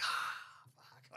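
breathy whispered "Ah, fuck" of dismay; retold whispered curse. Hearing everyone pled guilty, a whispered curse. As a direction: drop to a whisper as if it sinks in. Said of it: "you should see, I just went".

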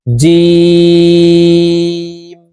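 জিম from the middle of the tongue, held long and sung; Arabic letter Jim. Shown as a demonstration. Teacher showing viewers জিম, long sung letter. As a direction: chant জিম slowly from mid-tongue. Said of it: "জিম হরফ জিহ্বার মধ্যখান থেকে উচ্চারণ করব".

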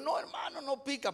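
high, light mock Oaxacan sing-song; Oaxacan lilt. Oaxacan hosts assuring the visiting preacher, high Oaxacan lilt. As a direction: imitate Oaxacan locals in a high, reassuring lilt. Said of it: "Me dijeron".

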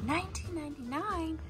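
high, excited quoting of a price; retold excitement. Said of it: "I got really excited".

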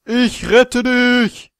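slow drawn-out hero call; heroic cry. Pretend. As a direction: play the dramatic rescuer. Heroic rescue cry in game.

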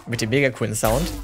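normal talking voice

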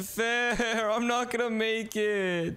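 high, drawn-out whine; whiny complaint. Real, not acted. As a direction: wail the words out in defeat. Losing the race, complains.

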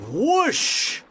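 high blowing whoosh sound. Acted. Narrator making the whoosh of the house falling. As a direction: blow the house away.

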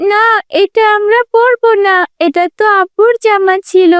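put-on high, upset child's protest; tearful little daughter. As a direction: refuse father's old clothes, near tears. (কাঁদো কাঁদো ছোট মেয়ের গলা)

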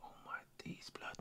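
almost soundless stunned whisper; barely audible whisper. Real, not acted. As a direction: mouth it, too shocked to speak. Stunned by the match.

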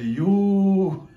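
loud, high, drawn-out cry of alarm; hammed-up frightened exclamation; mock fright. Shown as a demonstration. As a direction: hold it long and loud like a scared yelp. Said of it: "if somebody gets afraid, they might say".

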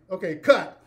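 loud set call of cut. Retold or imitated. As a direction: shout cut across the set. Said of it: "I say".

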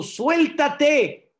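high, loud drawn-out order; shouted command. Acted. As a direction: call into the dark to let go. God's order re-enacted. (orden gritada)

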